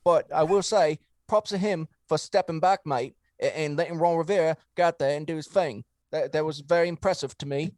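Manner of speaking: hamming up a British bloke with mate and all; put-on accent. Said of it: "that was a great accent".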